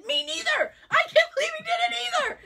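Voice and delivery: high, loud, fast near-sobbing wail; distraught shout of disbelief; anguished shout. Retold or imitated. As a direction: wail it like you're heartbroken. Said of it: "And I said".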